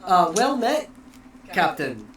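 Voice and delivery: loud, bright formal greeting; formal elf greeting. Acted. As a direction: hail the captain grandly.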